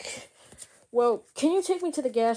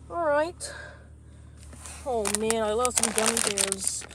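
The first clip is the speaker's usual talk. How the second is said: usual speaking voice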